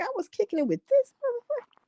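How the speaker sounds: high, quick put-on voice of disbelief; mock stunned friend. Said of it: "I'd have been like".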